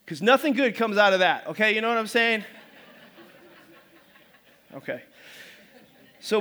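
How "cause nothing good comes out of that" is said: ordinary talk, nothing marked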